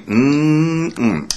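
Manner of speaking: long, high yummy "mmm"; savouring hum. Pretend. Receptionist eating pretend stupid pudding, savouring hum. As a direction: hum with hammed-up relish over a pretend mouthful.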